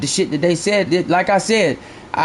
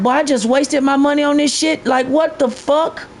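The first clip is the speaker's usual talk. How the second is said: high-pitched recalled disbelief; retold exasperation; 8 semitones higher than usual. Streamer recalling money wasted on the game, exasperated. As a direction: pitch it up, exasperated, lifting at the end.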